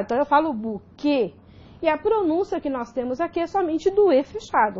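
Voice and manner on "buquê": "buquê" with a closed final ê; closed vowel. Shown as a demonstration. Teacher showing the class a closed pronunciation, "buquê" with closed ê. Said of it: "então a pronúncia é fechada".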